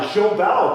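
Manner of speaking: "Jobel" said as zho-BELL; French pronunciation. Shown as a demonstration. Joking reuse of French pronunciation.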